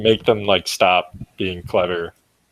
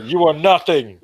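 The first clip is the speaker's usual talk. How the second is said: higher, scornful battle yell; in-character yell; 6 semitones higher than usual. Acted. Player's character spits on enemy corpses, yelling in contempt. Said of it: "and yell".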